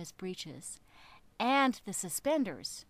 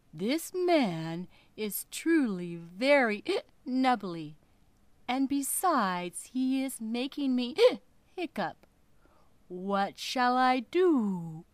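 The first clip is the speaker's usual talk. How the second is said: whale voice; 5 dB louder than usual. Acted. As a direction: drag it out like a huge hungry whale. Whale complains to fish.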